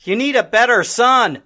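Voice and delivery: high, loud motherly telling-off; stern rebuke. Acted. Grandma confronting her son's mother, loud stern rebuke. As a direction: cut him down sharply.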